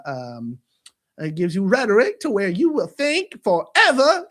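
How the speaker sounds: hammed-up Eddie Murphy style patter; Donkey from Shrek. Telling viewers about William, Donkey impression. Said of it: "I'm gonna do it in the donkey voice, like that Eddie Murphy voice".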